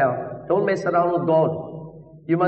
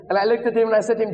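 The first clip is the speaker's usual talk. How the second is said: normal talking voice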